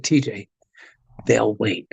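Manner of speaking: low, unhurried, easy brush-off; calm dismissal. Retold or imitated. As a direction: say it low and relaxed, no rush at all. [name] brushing off Metallica.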